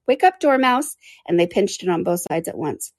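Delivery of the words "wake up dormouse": high-pitched wake-up call; mild, high cry. Acted. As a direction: call out to rouse a sleeper. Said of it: "they both cried".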